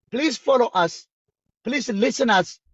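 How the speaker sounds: ordinary talk, nothing marked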